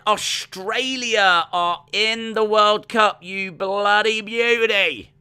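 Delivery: high, loud hammed-up Aussie accent; bad Australian accent. Reading a fan's message in a mock Australian accent. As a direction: ham up a terrible Australian accent. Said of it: "that was the worst Australian accent ever".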